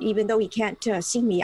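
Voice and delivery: usual speaking voice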